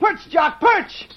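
high, loud urgent calls; short commanding shouts, pitched high; calling a falcon. Acted. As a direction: call out sharply to a bird overhead. Boy calls his falcon down.

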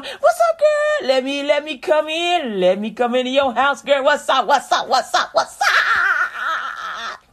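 playing a pushy flirt at the door, slowed and laughing at the end; hammed-up pickup line, chuckling through the repeats; mock smooth-talking guy. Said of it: "Tom, Richard, and Harry coming at you going".

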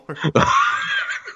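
high squealing laugh; shrieking laugh. Real, not acted. Laughs about not having Prime.

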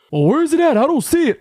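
high, loud quoted complaint; exasperated searcher. Retold or imitated. Stepdad on phone can't find wallet, loud and exasperated. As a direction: raise pitch and volume, flustered.

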